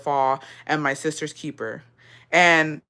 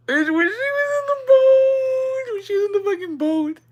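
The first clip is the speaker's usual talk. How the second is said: tearful. Real, not acted. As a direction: choke up and wail it, pitch way up. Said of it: "I'm already crying".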